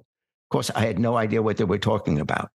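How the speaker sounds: normal talking voice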